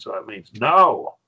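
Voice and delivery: NO punched loud and falling; emphatic no. Shown as a demonstration. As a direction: hit "no" hard as a firm refusal. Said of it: "Perschbacher says it means no".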